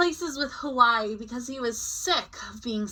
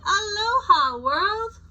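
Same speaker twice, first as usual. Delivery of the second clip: high, louder cheery hello; a bright aloha to the world; excited greeting; 10 semitones higher, 6 dB louder, slower than usual. A narrator's reading. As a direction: sing out a happy aloha. Reading a state's speech bubble.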